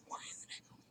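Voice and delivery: voiceless, very soft whisper; whispered question. Real, not acted. Streamer baffled by her stream echo, tiny whisper. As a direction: breathe the question to yourself.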